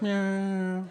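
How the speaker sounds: high, held whiny noise; imitated odd whine. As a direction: stretch one high, catlike note. Imitates neighbor's wordless reaction.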